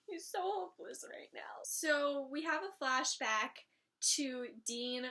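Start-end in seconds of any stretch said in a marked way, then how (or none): 0.1-1.6 breathless, voiceless whisper; hushed, heartbroken aside. Retold or imitated. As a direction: whisper it in despair. Her whispered reaction to Dean.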